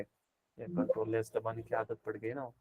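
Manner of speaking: very quiet, low-pitched mumble to self; muttered aside. Real, not acted. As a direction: drop to a barely audible, low aside. Aside about own typing habit. (धीमे, दबे स्वर में खुद से बात)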